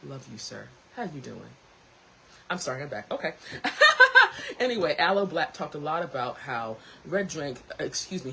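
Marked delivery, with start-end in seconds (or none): 3.4-4.5 high loud laugh; real laugh; burst of laughter. Real, not acted. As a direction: crack up at yourself. Laughing at herself.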